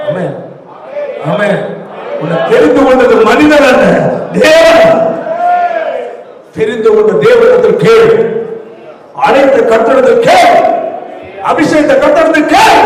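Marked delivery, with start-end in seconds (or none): 2.2-12.8 higher and louder, hammering each line; fiery pulpit exhortation; full-force preaching. Acted. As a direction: preach it hard and climb to a shout.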